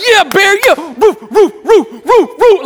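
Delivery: high, loud cheering and barking; frenzied woofs to rile up the dog; going crazy. Retold or imitated. Owner barking wildly with his dog Bear, going crazy. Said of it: "Like I'm going crazy".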